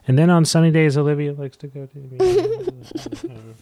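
lightly mocking his daughter's reading, laughing; a laughing hint of a child voice; mimicking daughter. Dad laughingly imitating his daughter reading the story. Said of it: "You're going".

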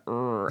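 quiet, low held-in growl; low muffled growl. Shown as a demonstration. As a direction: growl under your breath, holding it back. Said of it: "It's been more like a".

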